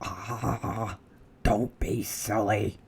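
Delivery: second burglar scoffing under his breath; dismissive whisper. Acted. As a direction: wave off the fear in a whisper. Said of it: "whispered the other burglar".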